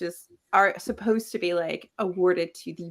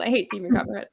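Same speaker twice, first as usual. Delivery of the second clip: usual speaking voice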